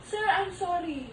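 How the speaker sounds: slow, soft and low, sincere sorry; gentle apology demo. Shown as a demonstration. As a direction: show how to apologise gently. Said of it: "hihingi ka ng sorry".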